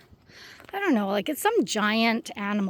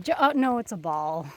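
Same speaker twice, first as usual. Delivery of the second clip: normal talking voice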